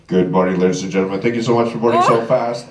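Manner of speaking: very low, monotone pilot voice; captain's announcement. Retold or imitated. Captain greets passengers on the microphone, deep announcer voice. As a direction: drop deep for a mock cabin announcement. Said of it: "I grabbed the microphone and I said".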